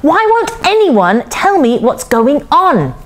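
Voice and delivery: high, drawn-out exasperation; slow, insistent high-pitched question; frustrated plea. A narrator's reading. Girl frustrated at being ignored.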